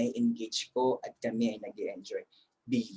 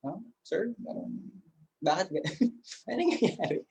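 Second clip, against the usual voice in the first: high, puzzled student voice; a confused student asking what's happening; 6 semitones higher, 4 dB quieter than usual. Retold or imitated. Students confused by Google Translate. (nalilitong estudyante, mataas ang boses)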